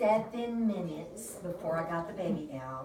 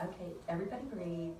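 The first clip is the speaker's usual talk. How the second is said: quiet calm; 2 semitones lower, 8 dB quieter, faster than usual. Retold or imitated. Calming the room.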